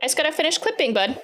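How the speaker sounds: pet talk voice. Real, not acted. Talking to dog, high baby voice.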